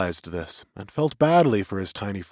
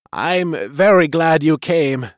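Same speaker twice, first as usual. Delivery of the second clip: slightly raised, louder character voice; higher, slow, glad little creature; glad Kirin; 5 semitones higher, 5 dB louder than usual. A narrator's reading. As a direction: greet a friend with slow, heartfelt relief. Till greets his friend.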